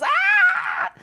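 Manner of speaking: sudden high loud cry; high startled shriek; squawk. Acted. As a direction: let out a sharp bird cry. Owl catches gnome, cries out.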